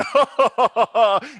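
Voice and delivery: loud, high villainous laugh; triumphant hammed-up evil cackle. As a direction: laugh loudly like a gloating villain. Villain laugh after the gloating threat.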